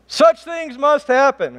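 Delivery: loud, high, slow shout; emphatic shout. Shown as a demonstration. Preacher emphasizing the verse to the room, slow emphatic shout. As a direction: belt it out slowly so nobody misses it. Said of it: "I wanna emphasize this right here".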